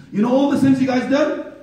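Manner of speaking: high, loud proclamation of mercy; ringing sermon promise; full-force preaching. Real, not acted. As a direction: proclaim it to the whole congregation, punching ALL. Preaches to congregation.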